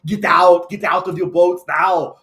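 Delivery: loud, slow Arnold order through laughter; Schwarzenegger as Jesus. Retold or imitated. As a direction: command the fishermen like the Terminator. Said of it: "awesome as Jesus in a movie".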